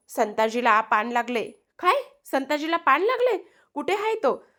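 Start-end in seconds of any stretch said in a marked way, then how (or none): 1.8-4.4 high-pitched, alarmed disbelief; shocked question. Acted. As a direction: repeat the news in shock, pitch shooting up. Shocked listener repeats the news.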